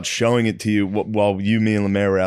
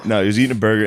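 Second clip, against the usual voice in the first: usual speaking voice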